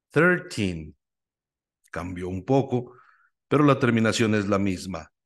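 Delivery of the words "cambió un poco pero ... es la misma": ordinary talk, nothing marked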